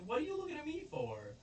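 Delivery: very high, quick retort; squeaky, fast, hammed-up protest; mock indignation. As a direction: imitate an affronted comeback. Accused woman retorts to kids.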